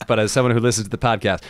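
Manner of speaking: ordinary delivery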